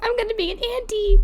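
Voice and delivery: softly squealed taunt; gleeful teasing. Acted. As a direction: tease in a small high voice. Teasing sister about fertility sign.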